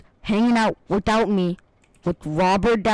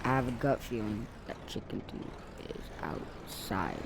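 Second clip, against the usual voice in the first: much softer, fading to a breathy whisper; 10 semitones lower, 15 dB quieter than usual. Real, not acted. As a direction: hush it like a secret. Hushed hunch.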